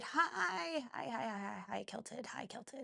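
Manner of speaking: raspy, voice giving out; smoker's rasp, losing voice; hoarse voice. Real, not acted. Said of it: "suddenly sounded like a smoker there".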